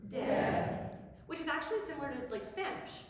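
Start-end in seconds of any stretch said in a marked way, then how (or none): 0.1-0.8 "De" ending in one tongue tap, sung loud; tapped final r. Shown as a demonstration. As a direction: tap the r at the end. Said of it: "it comes out as de".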